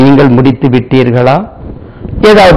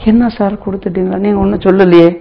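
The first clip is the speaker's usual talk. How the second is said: a confused listener asking, voice pitched up; puzzled bystander; 6 semitones higher, much faster than usual. Retold or imitated. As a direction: act out a baffled student. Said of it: "நான் உங்கள்கிட்ட ஒண்ணுமே சொல்லாம".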